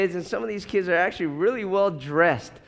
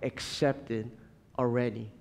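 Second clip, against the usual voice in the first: gently reassure, much softer; God speaking tenderly; 6 semitones lower, 6 dB quieter than usual. Pretend. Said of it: "And God says".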